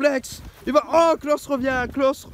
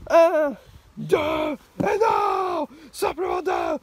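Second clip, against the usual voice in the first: shouted pin count; 5 semitones higher, slower than usual. Acted.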